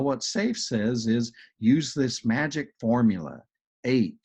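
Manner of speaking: ordinary talk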